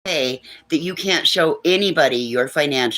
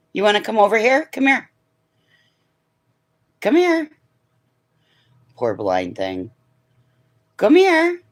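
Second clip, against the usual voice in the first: coaxing pet voice; 6 semitones higher than usual. Real, not acted. Calling the bird over.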